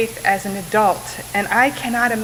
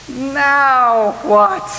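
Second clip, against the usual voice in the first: "Now what?" louder, higher and fed up; exasperated; 4 semitones higher, 6 dB louder than usual. Shown as a demonstration. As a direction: throw up your hands at "Now what?". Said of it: "my own intonation can sound a little bit more like".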